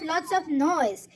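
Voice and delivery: usual speaking voice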